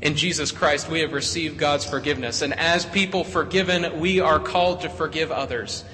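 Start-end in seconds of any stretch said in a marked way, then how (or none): none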